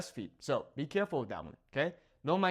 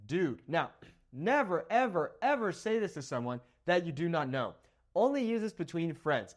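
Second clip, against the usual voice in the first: ordinary talk, nothing marked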